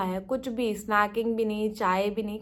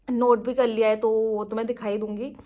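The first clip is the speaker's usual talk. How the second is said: ordinary delivery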